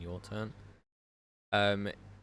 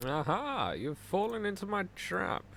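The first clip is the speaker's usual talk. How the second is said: gloating villain; 9 semitones higher than usual. Acted. GM springs a villain's trap.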